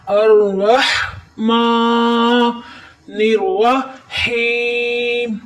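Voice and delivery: الرحمن الرحیم with throat ha and long ee, slow; throat ha, then hold the ee; throaty ha, held ee. Shown as a demonstration. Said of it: "ہا کی حلق سے آواز نکالتے ہوئے یا مدہ پر". (حلق سے ہا اور یا مدہ پر ٹھہراؤ)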